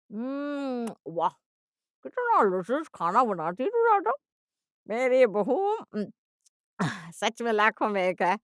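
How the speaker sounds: hammed-up relish, savouring every bite; over-the-top admiring mother-in-law; gushing praise. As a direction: flatter her lavishly, loud and swooping. Mother-in-law gushes over the food.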